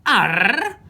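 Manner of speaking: an outraged captain's Arr; gruff angry pirate grunt; growled. A narrator's reading. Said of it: "growled Captain Shugle".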